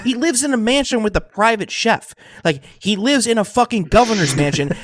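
high-pitched, incredulous indignation; worked-up and emphatic; exasperated rant. Real, not acted. Ranting about governor dining out.

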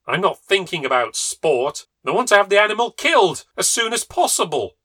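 loud, rising high angry outburst; fuming husband. A narrator's reading. Husband fuming at his wife, loud high anger. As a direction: demand the kill.

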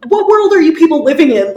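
loud, fast, incredulous cry; exasperated outburst. Real, not acted. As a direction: demand it in disbelief. Exasperated rant about critics.

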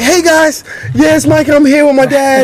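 imitating his son as a hyped YouTuber; kid YouTuber intro. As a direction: play the kid greeting his channel.

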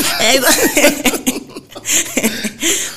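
bright rising laughter; long laugh. Real, not acted. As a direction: laugh freely at the memory. Laughing at memory.

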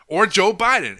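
a scornful laugh bursts into the name. Real, not acted. Mocking list of politicians.

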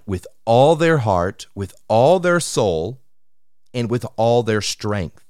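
"all" drawn out and pushed each time; stress on all. Shown as a demonstration. Preacher stressing wholehearted love to the congregation, "all" emphasized each time. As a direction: lean hard and slowly on every "all". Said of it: "Emphasis on the word all".

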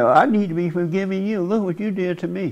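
a hint of the blaming mother; mother's voice. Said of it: "if she said".

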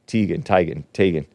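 "Teigen" as TEE-gen, TIE-gen, then TAY-gen; three low takes on "Teigen", changing only the first vowel; three pronunciations. Shown as a demonstration. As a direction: run through "Teigen" with ee, long i, long a, low. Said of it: "it's actually pronounced Chrissy Teigen".

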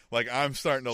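normal talking voice

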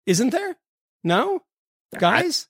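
fishing for the hosts to agree; teasing insistence. Pretend. Needling the other hosts about other news, mock incredulous.

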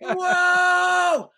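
long, very high mock shout; hammed-up whoop of amazement; imitated thrilled yell. First-time flyer as the plane takes off, imitated thrilled yell.

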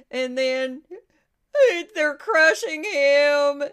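mock crying, drawn-out and loud; Lindsey Graham impression. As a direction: wail it slowly as a mocking tearful plea. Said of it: "I'm not the best Lindsey Graham impression".

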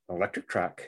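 usual speaking voice

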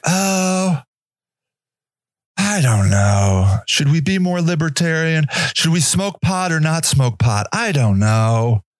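low, slow dithering mock voice; mock dithering debater. Mocking people who treat homelessness as a debate, slow and low. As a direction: drag out a clueless shrug.

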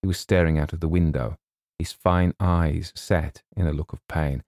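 plain reading voice

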